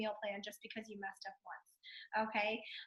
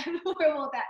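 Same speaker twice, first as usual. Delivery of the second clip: laughing; 4 semitones higher, 9 dB louder, faster than usual. Real, not acted. Admitting she ruins surprises.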